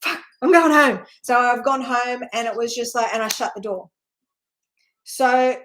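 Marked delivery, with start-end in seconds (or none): 0.0-1.1 spooked exclamation. Retold or imitated. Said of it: "and I'm like".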